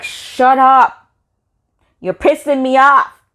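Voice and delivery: loud, high, re-enacted shout; exasperated outburst. Telling off the girl she snowballed, loud angry re-enactment. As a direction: raise pitch and volume, fed up. Retold outburst after smashing snow.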